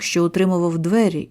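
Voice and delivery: ordinary delivery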